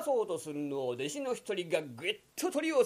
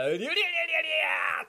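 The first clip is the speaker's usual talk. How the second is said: high strained grunting cry; straining effort cry; 10 semitones higher, much faster than usual. Acted. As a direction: heave with all your might. Straining to lift. (力を込めて踏ん張る声)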